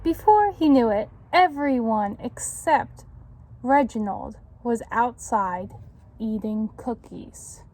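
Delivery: put-on lively read-aloud to children; story narration. A narrator's reading. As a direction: tell it like a picture book. Reads picture book to children.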